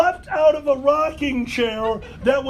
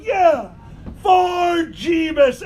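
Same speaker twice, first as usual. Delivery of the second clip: higher, drawn-out mock cheer; echoed toast yell; 4 semitones higher, slower than usual. Storyteller re-enacts joining buddy's drunken toast, yelling.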